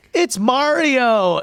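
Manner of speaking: put-on high, loud, drawn-out toddler cheer; excited kid's shout, stretched out; three-year-old. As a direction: imitate a little kid yelling out at the screen. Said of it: "this, like, three-year-old would go".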